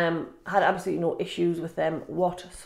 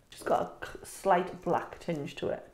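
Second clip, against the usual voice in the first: normal talking voice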